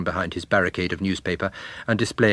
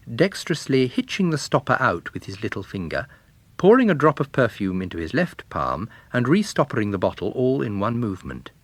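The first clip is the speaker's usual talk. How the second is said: ordinary delivery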